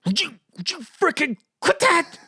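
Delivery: high-pitched pleading protest; imitated upset child. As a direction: squeal it like a scared, annoyed kid. Imitates his terrified son's protest.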